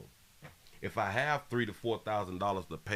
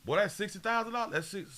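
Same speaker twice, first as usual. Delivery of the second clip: shocked exclaim; 11 semitones higher, faster than usual. Real, not acted. Shocked at the rent sum.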